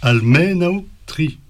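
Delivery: "Almenautri" drawn out slowly, MEN held high; stress on MEN. Shown as a demonstration. Teacher demonstrating where the accent falls, stress on MEN. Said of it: "l'accent tonique sur mais".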